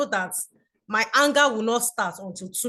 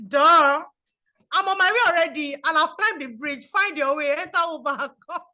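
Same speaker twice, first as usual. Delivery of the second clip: raised, louder offhand comeback; retold sassy reply, pitched up; dismissive retort; 4 semitones higher, 4 dB louder than usual. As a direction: brush off the upset caller. Acted reply to upset caller.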